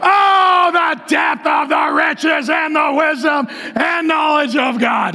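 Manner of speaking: shouted, drawn-out opening "Oh"; exuberant scripture reading. Shown as a demonstration. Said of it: "We read it like this".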